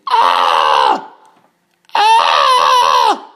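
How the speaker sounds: loud, low mock scream; imitated scream. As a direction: belt out a low scream like someone being arrested. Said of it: "we hear someone screaming".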